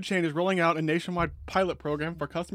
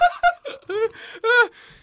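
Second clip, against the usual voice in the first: laugh-cry; 8 dB louder than usual. Real, not acted. Laughing and crying from heat.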